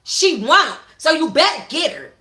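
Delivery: high, loud angry warning; snapping. Acted. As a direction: snap it down at him. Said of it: "Dior snapped".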